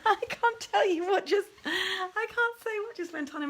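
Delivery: talking through a real laugh; high, laughing words. Real, not acted. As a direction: giggle out the words, caught by your own thought. Laughing at her own thought.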